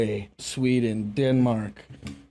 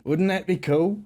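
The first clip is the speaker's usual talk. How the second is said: put-on Southern twang, higher pitched; Southern accent; 4 semitones higher than usual. Imagines the accent sticking, in mock Southern. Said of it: "if I start talking like this".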